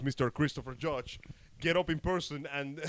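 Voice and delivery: usual speaking voice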